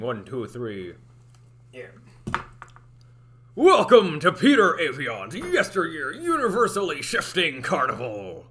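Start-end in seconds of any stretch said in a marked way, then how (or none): 3.5-8.5 slow, loud carnival barker; announcer voice. Shown as a demonstration. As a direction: boom out the grand welcome. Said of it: "trying to talk like an announcer".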